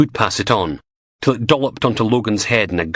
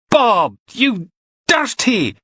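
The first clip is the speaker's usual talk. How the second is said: stretched-out, much higher disgusted cry; disgusted squeal; 4 dB louder, much slower than usual. Acted. Disgusted canteen cry at Bob's smell. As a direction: drag out the disgust.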